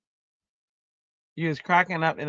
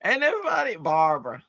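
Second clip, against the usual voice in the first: ordinary talk, nothing marked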